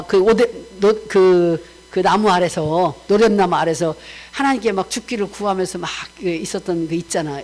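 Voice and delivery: usual speaking voice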